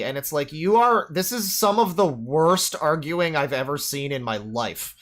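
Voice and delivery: usual speaking voice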